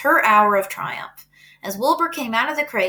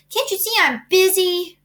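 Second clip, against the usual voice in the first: put-on high, annoyed kid voice; disgusted; 10 semitones higher, 3 dB louder than usual. A narrator's reading. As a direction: snap back, fed up. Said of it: "replied Avery in disgust".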